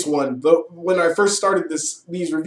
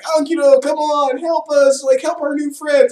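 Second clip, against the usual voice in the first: much higher, eager calling-out voice; pleading hero; 10 semitones higher, 4 dB louder than usual. Retold or imitated. Ramses calls on Ankido, high pleading. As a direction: urge the robot friend to help. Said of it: "And so he's like".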